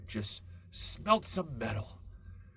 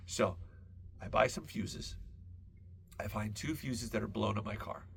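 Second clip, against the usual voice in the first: usual speaking voice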